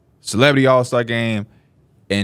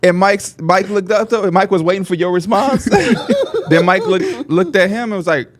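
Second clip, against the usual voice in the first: usual speaking voice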